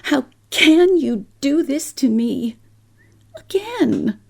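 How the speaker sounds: high, rising distressed plea. Acted. Mother pleading with Paul, high and hurt. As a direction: cry out to him in hurt disbelief.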